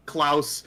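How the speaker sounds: "Klaus" as one syllable, rhyming with house; variant pronunciation. Shown as a demonstration. Fan listing pronunciations they've heard, variant "Klaus" in one syllable. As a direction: squeeze the name into a single "Klaus". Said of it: "so many different pronunciations".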